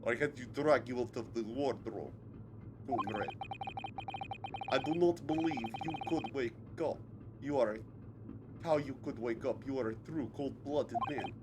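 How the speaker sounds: measured Russian villain voice; Russian captor. Acted. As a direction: play a stern Russian interrogator.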